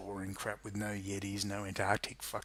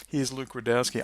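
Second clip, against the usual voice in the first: ordinary delivery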